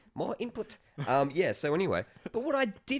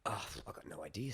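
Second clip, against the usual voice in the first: whispering; 6 semitones lower, 10 dB quieter than usual. Real, not acted. Whispered aside.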